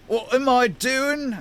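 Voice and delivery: high, drawn-out baffled cry; bewildered half-giant. Acted. Compelled half-giant carrying an old man, bewildered cry. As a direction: protest your own actions in slow, high-pitched alarm. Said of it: "and says".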